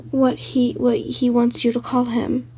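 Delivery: plain reading voice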